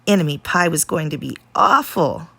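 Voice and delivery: ordinary delivery, nothing marked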